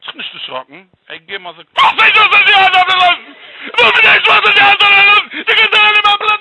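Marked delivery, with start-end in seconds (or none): 1.8-6.4 high, loud indignant yelling with a laugh; outraged shout. Acted. Sheikh shouting at the Rambam, high and loud. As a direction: yell it out in mock outrage.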